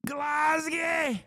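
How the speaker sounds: Glasgow said very high in mock Scottish, Glasgie; Scottish accent. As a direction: ham up Glasgow like a Glaswegian. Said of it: "Say Glasgow".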